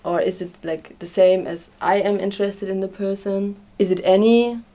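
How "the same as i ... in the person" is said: usual speaking voice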